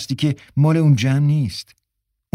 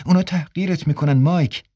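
plain reading voice

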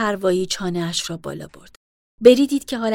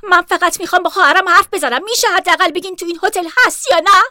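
higher, louder angry pleading; aggressive; 9 semitones higher, 8 dB louder than usual. Acted. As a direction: snap at the clerk, losing control.

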